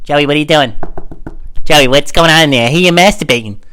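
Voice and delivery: loud mock-mom voice; loud, accusing put-on mom; scolding mom. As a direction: holler through the door like a suspicious mother. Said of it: "Your mom's, like, knocking on the door".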